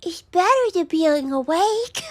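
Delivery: high, slow, loud cutesy squeak; cringe cute voice. Acted. Slime girl preferring sleep, high cringey cute voice. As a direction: go fully high and cutesy, drawn out. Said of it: "I gotta find the voice".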